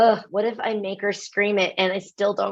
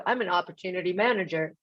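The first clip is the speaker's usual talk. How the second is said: mock jargon title; 3 semitones lower, 7 dB quieter, faster than usual. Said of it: "No one speaks like that".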